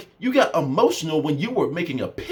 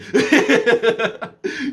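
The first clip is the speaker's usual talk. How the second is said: high burst of laughter; 6 semitones higher, 5 dB louder than usual. Real, not acted. Laughs at own reaction.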